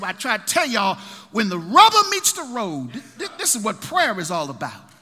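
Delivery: loud, high, rhythmic preaching; full-force preaching. Acted. Preacher building to a climax before the church. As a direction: drive it home to the congregation.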